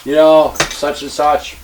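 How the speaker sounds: loud, high-pitched retold tirade; shouted rant, re-enacted; retold screaming. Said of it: "and I'm screaming".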